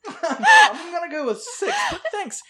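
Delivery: high, laughing insistence; laughing refusal. Real, not acted. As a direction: refuse through giggles, pitch way up. Teasing refusal while laughing.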